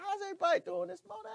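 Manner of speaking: bright, high, eager hello to viewers; excited greeting. Real, not acted. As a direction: greet everyone with a burst of excitement. Greeting the live audience.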